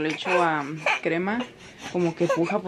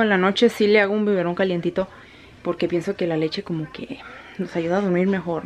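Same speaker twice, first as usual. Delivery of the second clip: ordinary talk, nothing marked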